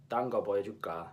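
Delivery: 딴 거 보여줄까, ㄹ dropped and clipped to 딴; lazy-tongue Busan take; Busan pronunciation. Shown as a demonstration. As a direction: Busan dialect contrast take. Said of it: "note the difference between".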